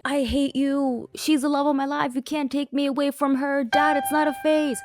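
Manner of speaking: mock-dramatic teen tantrum; whiny teen outburst. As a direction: play an angsty fourteen-year-old yelling at dad. Acting Rune's angry teen outburst.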